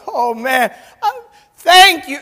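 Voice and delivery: high, loud burst of gratitude, played up; re-enacted overjoyed thanks. Preacher retelling his thanks for the cheque, overjoyed outburst.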